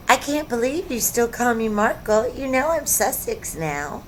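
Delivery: high, put-on complaining voice; mock duchess complaint. Mocking Harry's wife about her name, high put-on voice.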